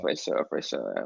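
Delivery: dropped very low and slow; low, slow rumble; deep voice. Pretend.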